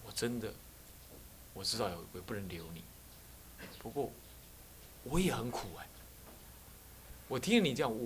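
quiet, tender plea to a student who wants to leave; soft consoling words. Retold or imitated. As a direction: speak softly, as if comforting someone in pain. Retold gentle words to departing student. (对想离开的学生轻声温和地劝慰)

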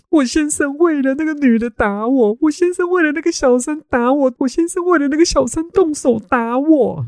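high wailing fake-victim sobbing; crying and wailing. Mother wails to police as a staged victim, hammed-up crying. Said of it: "就大哭大闹说".

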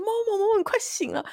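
high urgent calling of a name; frantic call. Retold or imitated. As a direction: shake a sleeper awake with your voice. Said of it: "疯狂叫我旁边那个人". (急切地高声呼唤)

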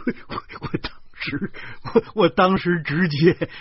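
high, wheezing laughing speech; breaking up, ending loud; helpless laughter. A narrator's reading. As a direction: struggle to get words out through laughter. Helpless laughter while telling the punchline.

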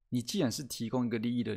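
ordinary talk

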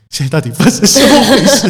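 bewildered onlookers. Retold or imitated. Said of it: "就说".

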